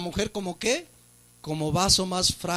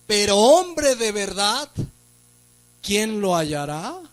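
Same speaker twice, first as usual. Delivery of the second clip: slow emphatic reading; 3 semitones higher, slower than usual. Acted. Preacher reads verse emphatically.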